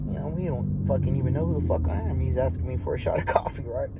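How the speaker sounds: whispered inner thoughts, slowed; incredulous whisper to himself about the psych patient; thinking to myself. Retold or imitated. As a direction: hush it as a private thought. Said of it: "I'm thinking to myself".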